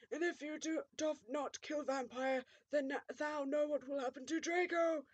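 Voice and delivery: lightly voiced pompous villain; villain threat. A narrator's reading. Voldemort warning about Draco, lightly voiced villain threat. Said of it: "he shouted".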